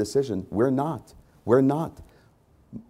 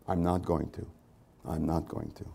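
each repeat softer, dropping away; fading to a hush; 6 semitones lower, 10 dB quieter than usual. Acted. Pastor trailing off his resolve, fading quiet. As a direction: let it die off into a near hush.

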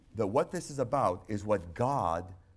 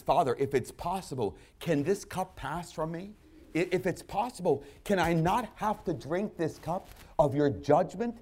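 high, pleading voice, building louder; Jesus pleading; 9 semitones higher, 6 dB louder than usual. Acted. Jesus begging the Father in the garden, high and anguished. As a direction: plead with the Father, rising to a cry. Voicing Jesus praying in Gethsemane.

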